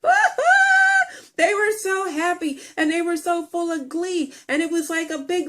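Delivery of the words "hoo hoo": shrill, much higher hammed-up guffaw; imitating honkies slapping knees and laughing; mock audience laughter. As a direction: ham up a gleeful crowd's cackling laugh. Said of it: "they're laughing".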